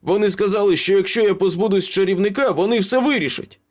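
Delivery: high-pitched frantic pleading; desperate confession. A narrator's reading. Beaten Ace confesses to Meryl, desperate. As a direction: blurt it out to avoid another blow.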